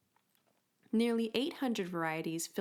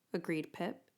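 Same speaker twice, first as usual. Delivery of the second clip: usual speaking voice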